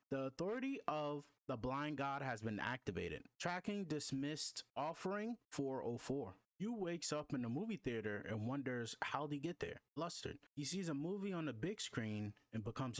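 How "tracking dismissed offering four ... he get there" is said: plain reading voice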